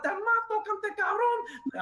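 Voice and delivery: high, slow Spanish cursing; wife cursing in Spanish. Retold or imitated. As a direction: spit the curse out slowly, high. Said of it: "she's, like, cursing that out".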